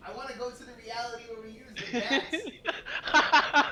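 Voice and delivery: high voice dissolving into laughter; breaking into laughter. Real, not acted. Friend delighted by the bat bomb plan, breaking into laughter. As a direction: crack up while saying it.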